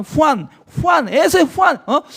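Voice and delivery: calling out the name in mock disbelief; mock exasperation. Pastor mimicking puzzled people, mock exclaiming the name. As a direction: exclaim the name, amused and incredulous.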